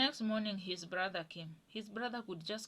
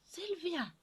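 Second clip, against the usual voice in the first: high, sweet-talking caller voice; higher voice of a mother faking friendliness in Swahili; mother's put-on voice; 8 semitones higher than usual. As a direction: play the mother-in-law putting on a sugary phone voice. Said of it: "You change your voice".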